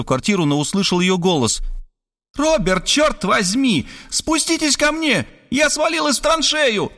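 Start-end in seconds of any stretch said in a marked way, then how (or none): 2.3-6.9 high, slowed, cross cry from below; woman stuck in a trench yelling up to Robert; exasperated woman. Acted. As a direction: call up from the mud, fed up. Woman fallen in trench calls.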